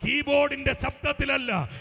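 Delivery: high, loud, relentless preacher's delivery; full-force prophetic preaching. Real, not acted. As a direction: proclaim it over the congregation, building to a shout. (അത്യാവേശത്തോടെ ഉച്ചത്തിൽ പ്രവചന പ്രസംഗം)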